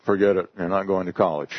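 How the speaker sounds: ordinary talk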